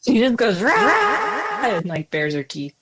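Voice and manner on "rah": high, drawn-out "Rah!" snarl; snarling war cry. Acted. Player acts Strong Knee intimidating goblins, snarl. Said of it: "what does it sound like when Strong Knee snarls".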